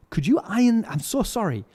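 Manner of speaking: mock-pleading retold apology; apologetic request. Comedian asks a young stage hand to iron his pants, mock apologetic.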